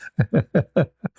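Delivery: rising laugh; high laugh. Real, not acted. Laughing at his own elevator story, high rising laugh. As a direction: let a high laugh break out.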